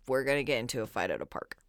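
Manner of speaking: low, fast deadpan husband voice. Retold or imitated. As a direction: state it flatly as a fact. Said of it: "He goes".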